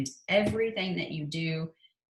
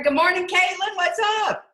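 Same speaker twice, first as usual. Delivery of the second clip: much higher, louder cheerful hello; excited high greeting to a friend arriving; bright greeting; 11 semitones higher, 6 dB louder than usual. Real, not acted. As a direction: welcome a friend warmly. Greeting a friend joining call.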